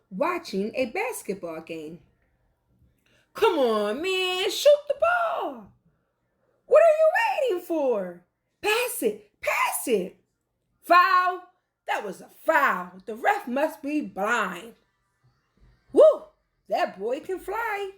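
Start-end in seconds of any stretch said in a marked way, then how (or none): 3.3-10.2 high, loud, fast fan yelling at a basketball game; sports fan shouting at the game. Acted. 10.8-14.8 protesting a foul. Acted. 15.9-17.9 high, loud whoop of delight; cheering. Acted. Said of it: "One man cheers".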